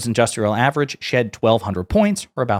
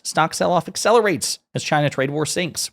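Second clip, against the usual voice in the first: normal talking voice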